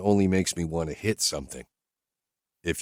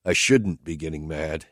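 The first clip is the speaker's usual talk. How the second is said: ordinary delivery, nothing marked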